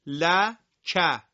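«ل، ک» as two separate loud syllables; syllables split apart. Shown as a demonstration. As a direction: break the word into its beats for the class. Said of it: "به صورت تفکیک میخونم".

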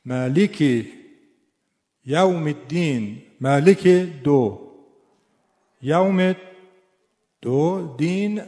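"مالک" and "یوم" punched loud and slow; emphatic recitation. Shown as a demonstration. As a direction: chant each word with its stress, strongly. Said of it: "بر اساس همین قانون".

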